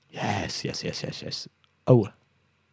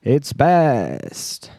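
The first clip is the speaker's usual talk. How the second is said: drawn-out emcee name call; announcer reveal; 2 semitones higher, 3 dB louder than usual. Pretend. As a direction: stretch the name like a showman.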